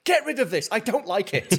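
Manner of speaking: high-pitched, fussy protest; acted indignant complaint. Acting a character's complaint at status effect.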